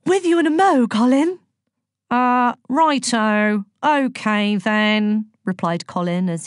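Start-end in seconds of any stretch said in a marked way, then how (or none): 0.1-1.4 high, loud singsong call; bright-pitched call to a client. A narrator's reading. Aphrodite calls out to her client. 2.1-5.3 slow, drawn-out dopey reply; higher, dithering character voice; hapless client. A narrator's reading. Hapless client Colin answers.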